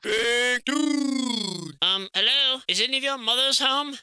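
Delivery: loud, high call to a giant statue; hailing a giant from below, loud and high. Pretend. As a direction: shout up at the big dude, high and loud. Calling out to a statue.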